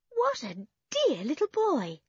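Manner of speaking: put-on high, delighted girl's voice; delighted cooing. A narrator's reading. Anne sees the small boy, delighted cooing. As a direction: coo over a little one.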